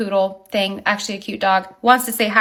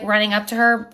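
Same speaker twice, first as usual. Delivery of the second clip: ordinary talk, nothing marked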